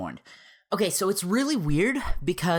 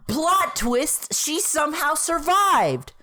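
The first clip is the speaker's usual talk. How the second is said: dramatic reveal; 7 semitones higher, 4 dB louder, slower than usual. Real, not acted.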